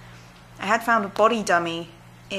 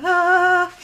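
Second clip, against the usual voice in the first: surprised squeal; 11 semitones higher, 7 dB louder than usual. Real, not acted. Reacting to cat.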